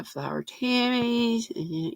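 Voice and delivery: usual speaking voice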